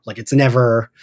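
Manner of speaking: ordinary talk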